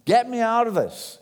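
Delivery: urgent retold prayer for help; crying out to God. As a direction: plead upward for rescue, slowing on the middle phrase. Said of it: "he cried out to God".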